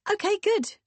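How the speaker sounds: very high, loud and breezy; booming hearty woman. Acted. Woman accepting the answer before leaving, breezy. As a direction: chirp it out heartily. Vicky's character voice.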